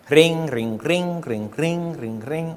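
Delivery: softening ring ring, fading each time; mock phone ring. Preacher imitating the collector's phone ringing, fading out.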